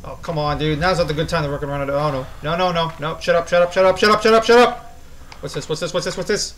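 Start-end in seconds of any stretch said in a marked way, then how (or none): 3.2-5.0 building yell. Real, not acted. Static noise of approaching monster.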